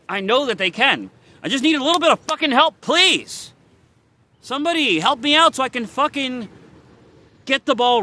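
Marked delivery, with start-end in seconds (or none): 1.4-6.5 higher, louder, fast pleading outburst; desperate angry plea. Real, not acted. Begging viewers for a chance, desperate and loud. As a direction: beg for help in frustration.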